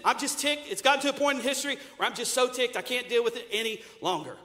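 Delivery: loud, fast, hammed-up rant; God venting his temper; mock fuming God. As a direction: parody of a ticked-off God.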